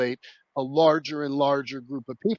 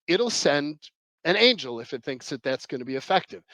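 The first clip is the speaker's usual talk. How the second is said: usual speaking voice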